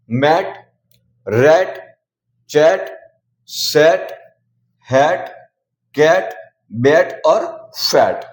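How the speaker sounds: मैट, रैट, बैट, फैट said with an open short "ae" vowel; each word spoken slowly on its own, a pause after each; short a demo. Shown as a demonstration. A teacher drilling students: the "ae" vowel said carefully, word by word. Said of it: "ए को ए पढ़ते हैं".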